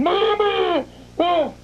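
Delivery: high imitated wail for Mama; mock child scream. Imitating four-year-old screaming behind the wall, mock scream. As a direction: cry out high like a trapped child. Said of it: "is screaming".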